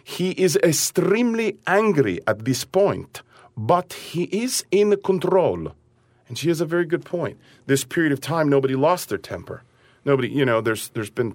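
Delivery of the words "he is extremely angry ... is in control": put-on slow, higher Italian-accented English; a fond imitation of an Italian accent. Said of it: "I'm gonna do her beautiful Italian accent for you".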